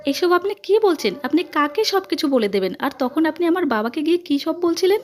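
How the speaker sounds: high-pitched, agitated challenge; alarmed questioning. A narrator's reading. Woman questioning the aunt about her father, agitated.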